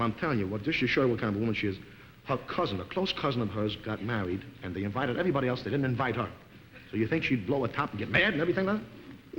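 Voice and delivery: ordinary delivery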